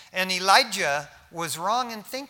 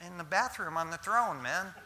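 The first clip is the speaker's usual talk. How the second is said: mocking taunt; 4 semitones higher, 6 dB louder than usual. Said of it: "He said".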